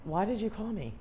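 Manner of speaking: soft, drained aside; mock deflated question. Listener after half an hour of venting, quietly deflated. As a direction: say it quietly, as if worn down.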